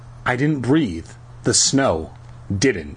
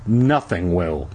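usual speaking voice

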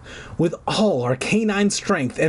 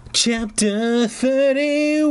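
slow, drawn-out chapter title; chapter heading; 11 semitones higher, much slower than usual. A narrator's reading. Narrator announcing the next chapter, slow and drawn out. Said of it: "chapter heading read".

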